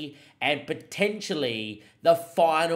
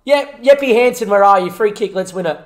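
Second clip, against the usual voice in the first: very loud victory commentary; ecstatic shouting; 5 semitones higher, 15 dB louder than usual. Real, not acted. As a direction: bellow it as the win is sealed. Winning goal seals the final.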